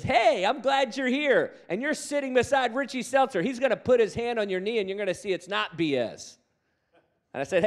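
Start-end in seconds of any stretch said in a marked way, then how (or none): 0.0-6.4 bold reply. Retold or imitated. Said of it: "And Ahab looks at him and says".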